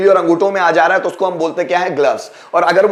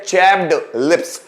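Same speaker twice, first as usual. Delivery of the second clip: slow repeat; slower than usual. Shown as a demonstration. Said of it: "क्या बोलते हैं?".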